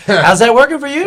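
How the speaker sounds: high, loud sarcastic outburst; mock sarcastic retort. Mock reply to the aunt's confession.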